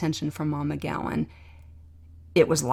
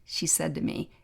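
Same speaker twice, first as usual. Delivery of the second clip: usual speaking voice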